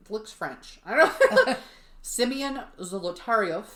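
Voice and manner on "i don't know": laughing. Real, not acted. Amused shrug.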